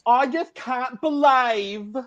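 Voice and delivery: high, slow mock Essex whine; Essex impression. Host does Bowie Jane as if from Essex, in a high slow Essex whine. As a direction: drag out every word in a put-on Essex accent. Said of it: "here's my impression of Bowie Jane if she was from Essex".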